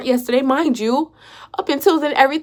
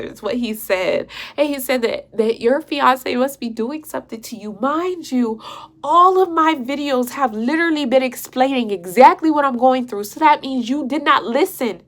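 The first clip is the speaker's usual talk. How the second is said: normal talking voice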